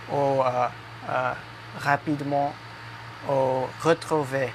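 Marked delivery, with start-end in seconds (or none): 1.8-2.5 rapidement with a throaty French R; rapidement, uvular R at the start. Shown as a demonstration. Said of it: "the French R sound". 3.8-4.5 retrouver with two throaty French Rs. Shown as a demonstration. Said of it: "the French R sound".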